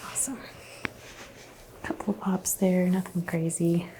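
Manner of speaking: ordinary delivery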